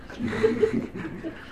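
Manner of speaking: high, airy laugh; real giggle trailing on after the punchline. Real, not acted. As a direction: laugh at your own joke. Laughs at own bubble bath joke.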